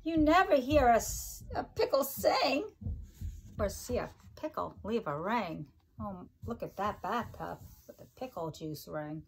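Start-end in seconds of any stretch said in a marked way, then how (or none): 0.0-5.7 sing-song rhyming read-aloud, each line bouncing; reading to children. Acted. Teacher reading a pickle rhyme book to her class, sing-song. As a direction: bounce the rhymes for little listeners.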